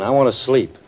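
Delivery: normal talking voice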